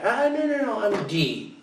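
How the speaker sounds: put-on high squeaky dismissive girl; mock daughter. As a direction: brush the name off like a teen girl.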